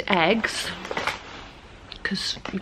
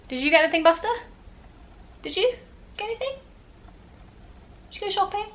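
high, soft questions to Buster; 11 semitones higher, 4 dB quieter, much faster than usual. Real, not acted. Asking little Buster about the shopping, high baby talk. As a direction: lift the voice high and coax gently.

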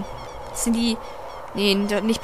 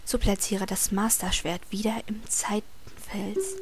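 whispered reading; 3 semitones lower, 7 dB quieter than usual. Acted. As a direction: read it like a secret. Reads game text in whispery voice.

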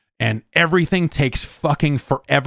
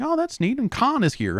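much higher, softer, gushing fan; mock delight; 12 semitones higher, 4 dB quieter than usual. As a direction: squeal it like a thrilled fan. Fan's delighted reaction acted.

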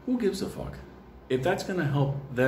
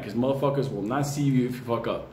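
ordinary delivery

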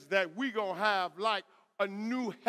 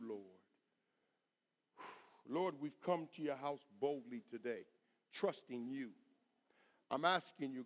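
soft, lower-pitched pleading prayer; hushed prayer; 6 semitones lower, 8 dB quieter than usual. Real, not acted. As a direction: pray softly and earnestly to God. Leads the congregation in prayer.

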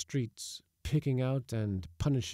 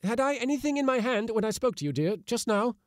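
flustered; 12 semitones higher than usual. Acted. Panicked husband questioning wife.

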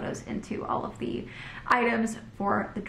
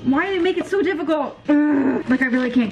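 frustrated; 5 semitones higher, 8 dB louder than usual. Real, not acted. Struggling to open container.

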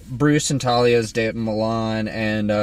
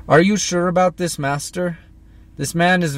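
high, loud henchman voice; raised pitch, earnest servant; Ubu voice; 8 semitones higher, 3 dB louder than usual. Acted. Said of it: "He's really gruff".

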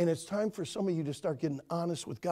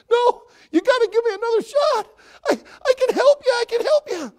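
very high, loud squeaky pleading; desperate clown; 13 dB louder than usual. Acted. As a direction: squeal out a frantic plea. Clown begging boss.

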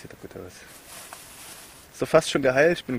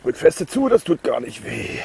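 gespielt tapfer; 3 semitones higher, 7 dB louder than usual. Pretend. Sarcastic while wound pressed.

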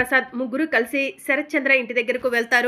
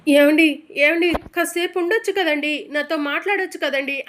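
louder, higher tearful plea; tearful cry; 3 semitones higher, 7 dB louder than usual. A narrator's reading. Meera begs her vanishing husband to stay, loud tearful plea. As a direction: cry out begging him to stay. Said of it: "మీరా ఏడుస్తూ ఉంటుంది".

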